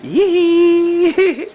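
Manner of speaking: long high-pitched "yee"; excited squeal. Real, not acted. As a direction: cheer with a drawn-out squeal. Cheering the child on.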